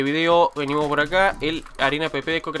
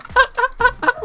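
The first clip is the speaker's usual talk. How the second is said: high laugh; 6 dB louder than usual. Real, not acted. Laughing at opponent in game.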